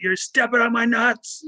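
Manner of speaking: high, slow strained call; retold yell. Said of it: "finally I did yell".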